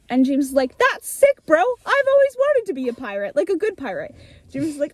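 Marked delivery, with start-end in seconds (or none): 0.8-4.1 excited bro. Retold or imitated. James thrilled to meet the pirate. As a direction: gush like a starstruck kid.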